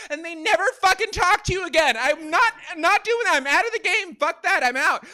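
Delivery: comic exasperated outburst; indignant rant. Acted. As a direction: declare you are done, fed up and worked up. Ranting, quitting dating apps.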